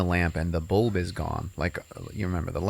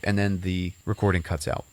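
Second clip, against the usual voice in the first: usual speaking voice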